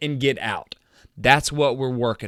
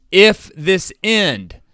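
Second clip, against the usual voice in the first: louder, higher and very slow, fired up; near yelling; 4 semitones higher, 5 dB louder, much slower than usual. Real, not acted. Coach fired up explaining a screen play, half yelling. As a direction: drive each word out slow and loud like on the field. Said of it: "and I feel like I'm yelling".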